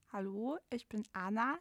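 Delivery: ordinary delivery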